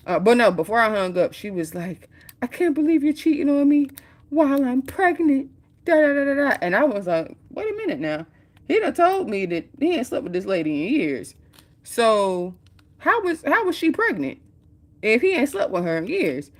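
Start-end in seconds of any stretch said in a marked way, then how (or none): 2.4-6.6 high, loud and outraged wife; wife's high accusation. Retold or imitated. Pregnant wife accusing her husband of cheating, high and loud. 7.5-16.5 high, disbelieving questions; incredulous surprise. Retold or imitated. Narrator catching the friend's lie, in high disbelief.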